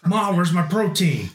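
high bro calling to mom; yelling across the room. Pretend. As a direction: shout for Ma from another room.

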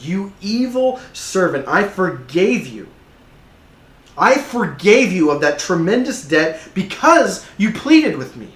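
slow, high, forceful rebuke; loud, measured royal anger; enraged king. Acted. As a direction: thunder it like a wronged king, word by word. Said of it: "the king is enraged".

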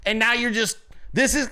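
usual speaking voice

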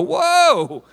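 high-pitched mock alarm; acting out an appalled churchgoer; shocked objection. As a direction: jump up high on a scandalized "whoa".

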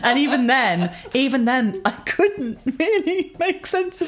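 laughing through the words. Real, not acted. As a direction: chuckle as you say it. Laughing while recounting cube attempt.

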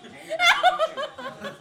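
high, slow, sing-song hello with laughter; hamming up a flirty hello to the waiter; flirty greeting. Retold or imitated. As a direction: lay on the charm in a high, drawn-out voice. Imitating his own flirty greeting.

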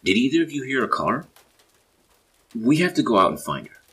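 loud, high, frantic urgency; worked-up, high-pitched calling; growing frenzy. A narrator's reading. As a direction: shout it in rising panic. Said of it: "Aaron continued in a growing frenzy".

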